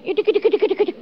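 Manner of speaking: high, light "idik, idik" for dainty steps; mimicked footsteps. Preacher imitating a woman walking in his dream.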